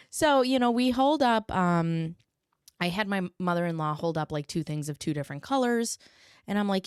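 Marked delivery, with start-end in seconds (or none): none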